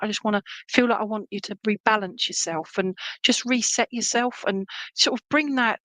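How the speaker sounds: ordinary delivery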